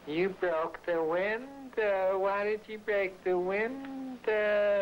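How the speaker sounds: high, slow mimicking of Linda; whiny high voice as Linda. Retelling Linda yelling.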